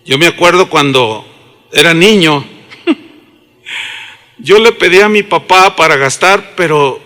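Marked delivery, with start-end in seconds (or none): none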